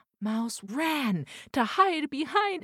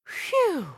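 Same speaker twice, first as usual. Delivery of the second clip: relieved sigh; 11 semitones higher, 6 dB louder than usual. Acted. Relief after cat leaves.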